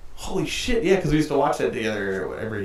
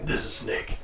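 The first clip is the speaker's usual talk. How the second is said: Snake voice; 2 semitones lower, 7 dB quieter, slower than usual. Retold or imitated. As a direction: whisper it like Snake. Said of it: "my best, uh, Snake impression".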